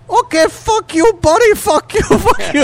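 very high, mock-furious screech; high-pitched cartoonish cursing fit; screaming scam caller. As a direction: impersonate an enraged phone scammer losing it. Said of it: "they'll start screaming at you".

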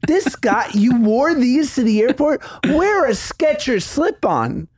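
high mock-outraged rant about boots; incredulous rant. Real, not acted. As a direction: rant in comic disbelief. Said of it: "ranting about combat boots".

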